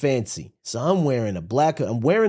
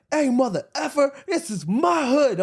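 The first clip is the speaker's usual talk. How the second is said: tough-guy threat; 10 semitones higher than usual. Retold or imitated. Customer threatening the cashier over napkins, high mock bravado. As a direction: puff up and claim the turf. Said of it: "the other dude was like".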